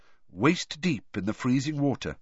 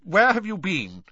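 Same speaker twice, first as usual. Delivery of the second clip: higher, bossy reproach; bossy, disapproving; 8 semitones higher, 4 dB louder than usual. A narrator's reading. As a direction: scold a latecomer, higher. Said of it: "said a bossy, disapproving voice".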